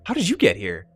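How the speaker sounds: high, loud burst of recognition; startled outcry that drops at the final question; astonished daughter. Acted. Daughter recognises the man who saved her, astonished.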